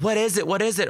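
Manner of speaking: quick, eager mock questions from a class; excited kids. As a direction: act out kids clamoring to know.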